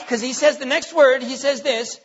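ordinary talk

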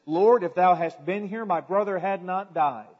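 loud pleading Martha; Martha's plea. Retold or imitated. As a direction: cry out as grieving Martha. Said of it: "Martha came running out to Jesus and said".

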